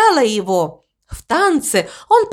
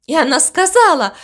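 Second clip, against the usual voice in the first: ordinary delivery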